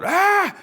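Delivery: short, loud, high yelp of alarm; freaked-out scream. Acted. Pitch artist voices Mater freaking out at the clock, startled scream. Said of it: "Mater freaks out".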